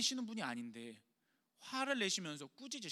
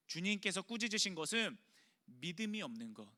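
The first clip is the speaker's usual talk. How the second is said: normal talking voice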